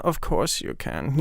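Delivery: soft, slow caressing reply; slow flirty. Retold or imitated. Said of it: "caressing those words".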